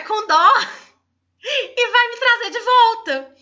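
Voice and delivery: giggling at her own plan to fake crying; laughing. Real, not acted. (rindo enquanto fala, voz mais aguda e mais alta)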